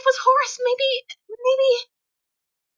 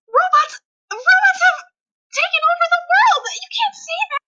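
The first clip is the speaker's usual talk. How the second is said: raised, high-pitched cry; alarmed protest; 6 semitones higher than usual. Acted. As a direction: cry out in disbelief at the doctor. Alarmed protest against the doctor.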